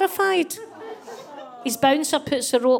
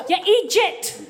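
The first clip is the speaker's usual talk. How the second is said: higher, louder mock telling-off; mock scolding; 8 semitones higher, 4 dB louder, slower than usual. Wife to husband who turned down the party, mock scolding. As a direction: scold him half laughing.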